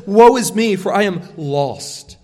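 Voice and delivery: loud, despairing outcry; Isaiah crying out before God's holiness; anguished cry. Acted. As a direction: cry out as a man undone. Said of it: "And he says".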